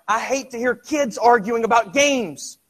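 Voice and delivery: high, loud, fast impassioned preaching; full-force preaching. Acted. Preacher presses the congregation on inner struggle, loud and fast. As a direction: drive it home to the congregation.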